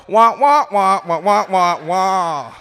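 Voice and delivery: loud, honked cartoon grown-up wahs; Charlie Brown adult noises. Kid's deaf parents as Charlie Brown adults, loud wah-wah imitation. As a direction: blare wordless trombone-like wahs, falling off at the end. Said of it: "the adults really did go".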